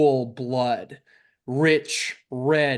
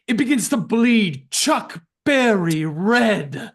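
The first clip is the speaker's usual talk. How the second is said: dramatic narration; 6 semitones higher, 6 dB louder than usual. A narrator's reading.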